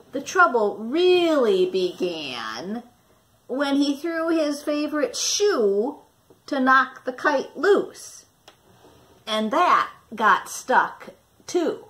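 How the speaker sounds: put-on lively storybook narration for young listeners; reading to children. Teacher reading Stuck to her class, lively storytelling voice. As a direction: tell it like a picture book to a class. Teacher reads picture book aloud.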